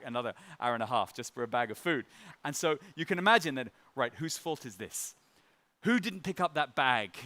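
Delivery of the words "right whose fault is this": ordinary talk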